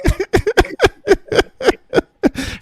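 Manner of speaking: long, high, loud laugh; helpless high-pitched belly laugh. Real, not acted. Host teasing panelist to talk to himself, loud high laughing. Group joking about self-talk.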